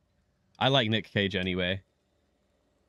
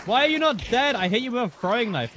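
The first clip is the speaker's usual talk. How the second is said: loud, high disbelief; shocked outburst; 12 semitones higher, 5 dB louder than usual. Real, not acted. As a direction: yell it in shock. Shocked enemy survived hit.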